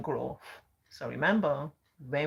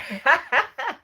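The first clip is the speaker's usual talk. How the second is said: bursts out laughing, high and loud; a sudden, genuinely amused laugh; real laugh; 10 semitones higher, 9 dB louder than usual. Real, not acted. Laughing at viewer comments.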